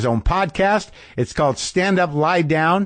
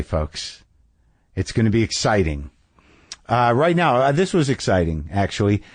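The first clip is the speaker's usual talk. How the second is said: usual speaking voice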